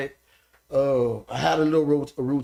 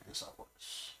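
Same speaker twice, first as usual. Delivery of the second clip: whispered aside; 22 dB quieter than usual. Real, not acted. Repeats answer, dropping to whisper.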